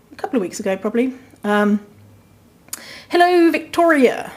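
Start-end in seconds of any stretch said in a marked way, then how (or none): 3.1-4.3 high, put-on voice for a stranger's greeting; odd put-on greeting. Shown as a demonstration. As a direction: read the greeting in a much higher, unfamiliar voice. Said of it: "Don't know why I spoke like that".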